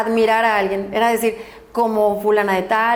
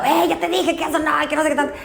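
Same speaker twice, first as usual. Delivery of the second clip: scolding; 3 semitones higher, faster than usual. Retold or imitated. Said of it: "te están regañando los más grandes".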